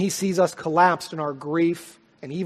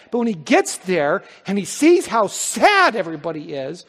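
raised, emphatic sermon voice; louder, driving pulpit delivery; forceful preaching; 4 semitones higher, 7 dB louder than usual. Acted. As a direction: preach it out with mounting urgency.

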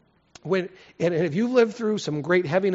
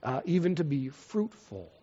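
prayer; 4 semitones lower than usual. Real, not acted. Leads the congregation in prayer.

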